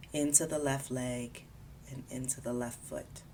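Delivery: calm, unhurried body scan guidance; guided meditation. Acted. Guiding a listener through a body scan, calm meditation voice. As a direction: ease the listener gently from part to part.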